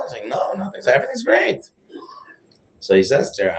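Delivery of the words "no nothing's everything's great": higher, brushing-off lady's voice, lightly played; breezy reassurance in a higher voice. Lady reassuring the rabbi that all is fine, higher voice.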